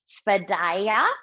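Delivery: svadhyaya said slowly, each syllable spaced out; careful Sanskrit word. Shown as a demonstration. Teacher to yoga class, careful slow Sanskrit word. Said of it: "I have to say it very slow".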